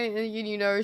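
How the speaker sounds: usual speaking voice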